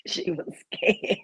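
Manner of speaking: slow hushed whisper. Real, not acted. Telling the co-host about the singer, whispered. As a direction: let it slip out as a secret aside.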